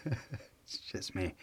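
soft, slow self-mocking aside; quiet sheepish mutter. Real, not acted. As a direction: mumble it to yourself, embarrassed. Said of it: "is it hot in here".

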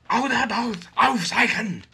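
high, loud, slow mock-angry shout; mock angry German. Snack taster rereading German packaging angrily for laughs, mock angry shout. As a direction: bellow the German words as a hammed-up tirade. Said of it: "if you say it, like, angrily, then you just sound like an angry Nazi".

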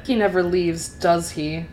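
plain reading voice

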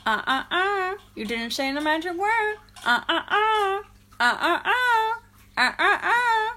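high, loud taunting singsong; Dennis Nedry impression. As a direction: wag a finger at them vocally. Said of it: "And then my favourite".

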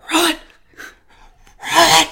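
high, loud shout under the breath; whisper yelling. Shown as a demonstration. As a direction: yell it in a hushed whisper. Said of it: "it's a whispery shout".

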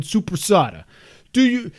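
lightly imitated New York pizza man; New York accent. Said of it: "he kept saying".

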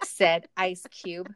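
slow, clearly spaced words; slow clear repeat. Shown as a demonstration. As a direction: repeat it slowly for a bad line. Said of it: "Sorry, say that again".

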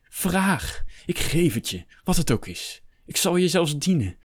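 high, swooping desperate plea; urgent pleading, pitch leaping up; begging. A narrator's reading. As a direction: implore him to save your soul. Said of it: "smeekte Hector".

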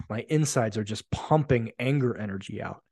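ordinary talk

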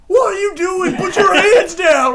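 loud, high-pitched imitated cop shout; shouting officer. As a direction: yell the order, dragging it out. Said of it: "he's like".

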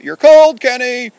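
high-pitched, gleeful game shout. Acted. Toy soldiers calling to Kenny in a hiding game, shouted. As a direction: call it out loud and very high. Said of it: "and we shout".

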